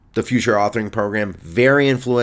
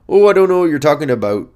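quick, higher put-on Canadian; Canadian accent; 4 semitones higher, 4 dB louder, faster than usual. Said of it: "I've done a Canadian accent".

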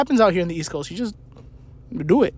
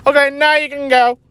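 high, put-on signalling voice; mock walk sign; 9 semitones higher, 3 dB louder than usual. Sign telling pedestrians to cross, mocking voice. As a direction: announce it in a raised, silly voice. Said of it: "wait for a sign and be like".

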